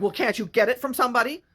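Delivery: loud, high-pitched pushy demand; retold yelling. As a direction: imitate her yelling about money. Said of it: "yelling at me about money".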